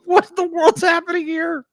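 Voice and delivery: high-pitched, mock-baffled outburst; incredulous reaction. Acting out own startled reaction.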